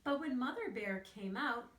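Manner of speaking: normal talking voice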